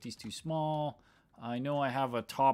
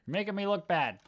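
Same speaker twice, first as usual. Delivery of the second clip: higher-pitched mock complaint; grumpy; 7 semitones higher, 3 dB louder than usual. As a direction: imitate a grumpy colleague griping. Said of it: "Russ was grumpy at me".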